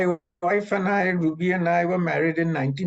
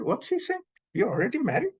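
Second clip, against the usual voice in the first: surprised disbelief; 8 semitones higher than usual. Retold or imitated. Baba surprised by dad's claim.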